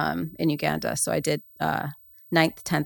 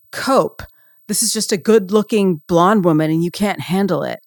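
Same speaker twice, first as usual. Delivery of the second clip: mock edge bro; 2 semitones higher, 5 dB louder than usual. Mocking online edge bros.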